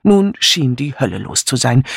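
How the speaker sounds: plain reading voice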